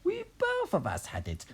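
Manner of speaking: very high granny screech; shouting Grandma. A narrator's reading. Grandma explaining to Mary, very high mock screech. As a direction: screech it in a put-on old voice. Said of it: "Grandma shouted".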